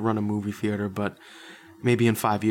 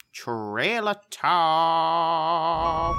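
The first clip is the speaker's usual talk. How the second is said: slow, drawn-out segment title; announcer voice; 4 semitones higher, 4 dB louder, much slower than usual. Acted. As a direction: announce it like a show bumper.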